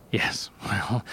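breathy whispered laugh; a hushed, awkward whisper. Acted. Comedian whispering nervously to the tired mother, whisper. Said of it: "Why do you keep whispering?".

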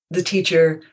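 normal talking voice

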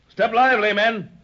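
loud, pressing order; urgent command. Acted. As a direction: hurry the crew along. Captain urges crew to hurry.

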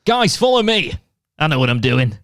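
mock keen leader. Friend mocks a cocky paintballer leading the team. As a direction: play the overconfident paintball hero. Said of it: "There was a guy who went".

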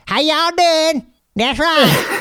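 high hammed-up Southern drawl; Southern accent. Teasing Josh about how he sounds, mock Southern accent. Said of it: "all I hear is".

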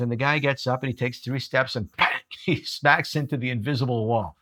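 normal talking voice

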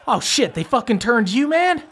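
high, rising disbelief; acted shocked man. Man holding the door sees his turned acquaintance, high shocked question. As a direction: play him startled, pitch climbing. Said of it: "he looks at you, and he goes".

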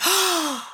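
high, sudden intake of breath; a moved little gasp; sharp gasp. Retold or imitated. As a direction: gasp as it dawns on you. Retelling moment of realization.

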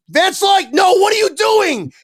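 high, loud alarmed yell; shouted warning. Retold or imitated. Stranger on the slope yelling at Vince. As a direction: yell at him to stop. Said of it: "then somebody goes".

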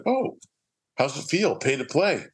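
high, mocking "how's it feel"; gloating tease. Gloating at Hans over the bill, mocking. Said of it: "And I was like".

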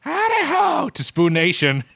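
slow, high host greeting; show greeting. Acted. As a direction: belt out the show opener. Podcast show opener.

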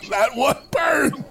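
slow strained words after a shot; throat burning from vodka. Real, not acted. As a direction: still feeling the vodka burn.

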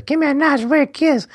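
high silly put-on voice; Mouth's joke voice. Mouth clowning with the painting, in a high silly voice. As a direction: squeal it as a goofy tease. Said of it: "He says".

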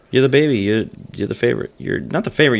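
ordinary talk

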